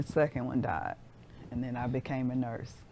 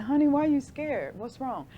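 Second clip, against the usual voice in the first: higher, worried question to grandmother; alarmed question; 10 semitones higher, 4 dB louder than usual. Retold or imitated. In the dream, asking her grandmother on the phone, alarmed and higher. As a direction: ask Honey in sudden alarm.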